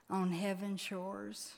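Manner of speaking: usual speaking voice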